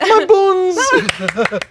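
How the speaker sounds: very high fey voice; foppish, fey character. Pretend. Fey creature explaining himself to a laughing friend, in a foppish voice. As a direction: answer as a precious little fop. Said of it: "Very foppish".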